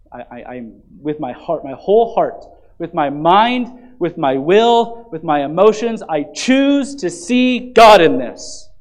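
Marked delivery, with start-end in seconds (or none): 1.0-8.7 louder and slower, each phrase weighted; building proclamation. Acted. Pastor declaring his own choice to the church, slow building proclamation. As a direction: declare it with growing resolve.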